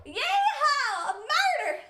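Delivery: high Southern whoop from far back; loud off-mic yell. Acted. Reporter whooping over a murder scoop, high distant holler. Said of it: "Hope that was loud enough back there".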